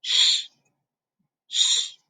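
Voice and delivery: SH sounded alone as a hushing consonant; SH said separately, twice, with a pause between; consonant demo. Shown as a demonstration. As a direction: isolate SH, no vowel after it. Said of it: "pronounced as".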